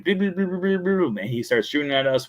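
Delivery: high rapid-fire shooting sound; imitated firing noise. Imitates the termite firing acid.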